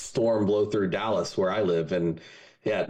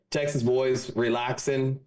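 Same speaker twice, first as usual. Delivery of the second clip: ordinary talk, nothing marked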